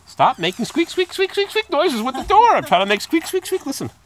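loud complaint with piercing squeak imitations; loud call-out with squeaks. Real, not acted. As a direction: call out loudly, breaking into high squeaks. Calls out over squeak noises, imitating them.

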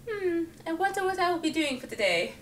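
high, airy mock-posh musing; pretend aristocrat. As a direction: play a dainty aristocrat sipping tea. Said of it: "I pretend I'm an aristocrat".

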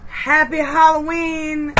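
high, slow, beaming holiday cheer; excited. Real, not acted. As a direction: sing out the greeting with delight. Festive greeting to viewers.

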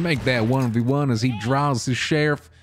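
put-on cowboy narrator commentary; Western narrator. Narrating a one v two sheriff fight, cowboy voice.

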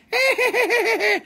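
shrieking high witch laugh; witch cackle. Pretend. Flying the witch crystal across the screen, high cackle. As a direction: let the witch cackle fly.

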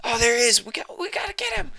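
high, hurried father voice; frantic dad. Pretend. Father spotting his lost son, high hurried voice. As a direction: rush the words like a worried dad.

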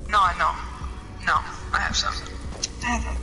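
ordinary talk, nothing marked

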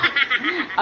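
high giggle from being tickled; ticklish giggle. Real, not acted. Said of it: "nakikiliti ako".

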